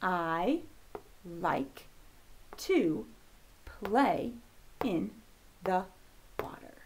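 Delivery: measured, halting read-along for beginners; reading word by word. Shown as a demonstration. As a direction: separate words with a pause after each. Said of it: "let's read our sentence".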